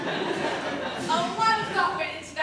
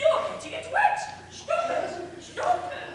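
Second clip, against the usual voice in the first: squealing much higher; 11 semitones higher, 4 dB louder than usual. Acted. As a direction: shriek it in a high voice. Vendor reacting in high voice.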